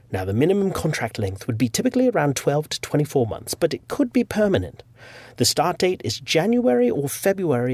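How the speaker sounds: playful mock-British recruiter voice; [name]. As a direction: read the email in your best British accent. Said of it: "do my best British accent".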